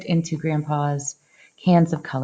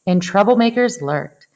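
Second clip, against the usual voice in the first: ordinary delivery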